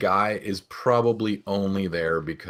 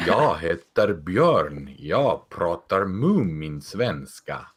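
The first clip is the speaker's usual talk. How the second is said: put-on Moomin Swedish accent; much slower than usual. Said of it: "you have the Moomin Swedish".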